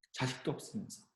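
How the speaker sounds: a rival wife needling her, softly; sneering taunt. Retold or imitated. Rival wife taunting Hannah, hushed and spiteful. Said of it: "되게 막 얄밉게 막 하는 거예요".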